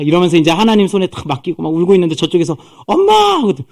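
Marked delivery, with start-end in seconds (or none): 2.9-3.4 very high, acted child's call; high-pitched hail to a distant mother; girl calling Mom. Miriam calls from over there.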